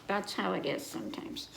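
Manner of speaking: usual speaking voice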